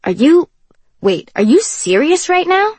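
can't believe what he just heard; shocked disbelief. Acted. Kenji stunned by her rudeness.

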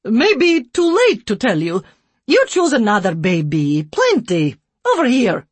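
loud, dismissive broken English. A narrator's reading. Care worker pushing another baby, loud broken English. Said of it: "in broken English".

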